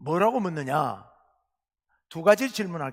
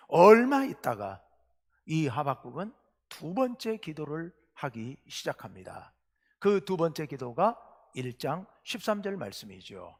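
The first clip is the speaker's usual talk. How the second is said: normal talking voice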